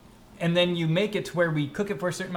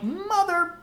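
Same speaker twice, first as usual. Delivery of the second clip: high, drawn-out frustrated groan of a curse; exasperated curse; 11 semitones higher, slower than usual. Retold or imitated. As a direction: let the annoyance burst out high. Remembering the annoying instructions again.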